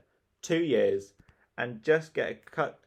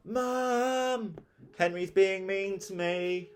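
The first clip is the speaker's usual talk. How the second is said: high, slow wailing complaint; louder wail; 10 semitones higher, much slower than usual. Acted. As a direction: wail it out to be heard. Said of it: "Peter started to wail louder".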